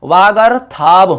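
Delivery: वागर्थाब slowly, with a long था; sandhi word demonstration. Shown as a demonstration. Teacher repeats the newly joined word, slow demonstration. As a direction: stretch out each part of वागर्थाब. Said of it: "वागर्थाब बन जाएगा".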